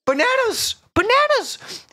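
high excited squeal of bananas; excited shriek. Real, not acted. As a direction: shriek it out with glee. Sudden excited realization.

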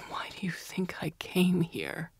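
slow, whispered, worn out; resigned whisper. A narrator's reading. Resigned prisoner answering his torturer, slow whisper. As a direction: let it go, beaten.

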